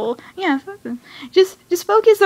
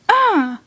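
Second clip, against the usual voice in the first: high frustrated "ah"; exasperated cry; 7 semitones higher than usual. Acted. Fan fed up with the villain, exasperated yell. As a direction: let out a high burst of mock frustration. Exasperated before flipping a desk.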